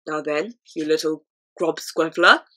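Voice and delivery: low booming giant voice, big and menacing. A narrator's reading. Flesh Lump Eater sneering at the BFG, booming giant. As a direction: boom it down at the little grub. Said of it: "boomed the Flesh Lump Eater".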